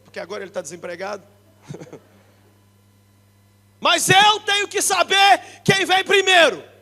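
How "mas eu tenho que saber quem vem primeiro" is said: high, loud and slow, every word hammered home; preaching shout. Real, not acted. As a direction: declare it to the church at full force. Preacher drives home a point.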